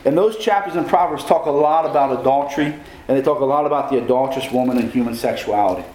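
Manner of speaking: normal talking voice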